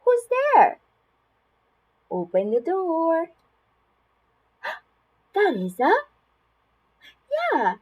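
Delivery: sing-song, exaggerated high swoops with stretched words; animated picture-book reading with big gasps; reading to children. Shown as a demonstration. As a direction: play it up for a toddler, full of wonder. Reads a lift-the-flap book to a child.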